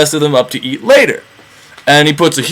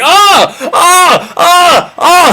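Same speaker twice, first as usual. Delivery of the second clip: high, loud mock screams of terror; blinded cyclops screaming in hammed-up panic; terrified screams; 5 dB louder, much slower than usual. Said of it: "like, terrified, going".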